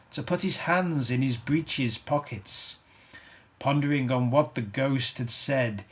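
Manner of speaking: ordinary delivery, nothing marked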